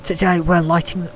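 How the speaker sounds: mock posh English accent. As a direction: put on a touch of posh English. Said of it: "act posh for a minute".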